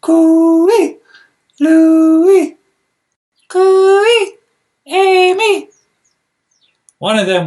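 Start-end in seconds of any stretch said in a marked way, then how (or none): 0.0-5.7 put-on two children trading long high calls; calling out. A narrator's reading. Amy and Louie calling across the fence, long high calls. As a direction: call back and forth over the fence. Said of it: "they called to each other across the fence".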